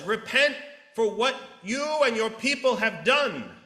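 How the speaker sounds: loud, high proclamation; prophetic call to repent. Retold or imitated. Jonah commands the king to repent, loudly. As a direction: thunder it at the king.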